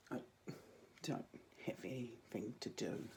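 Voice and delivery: a sheepish whispered admission. Real, not acted. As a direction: confide it in a whisper. Joking confession aside.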